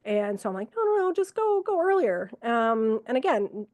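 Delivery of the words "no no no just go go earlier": high, eager insistence; high urgent encouragement; urgent nudge. Retold or imitated. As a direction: push him to go sooner. Urging patient to go sooner.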